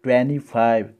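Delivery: ट्वेंटी फ़ाइव stressed on the नी; American twenty. Shown as a demonstration. Teacher showing American ट्वेंटी फ़ाइव. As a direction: lean on नी in ट्वेंटी. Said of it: "नी पे जोड़ दिया जाता है".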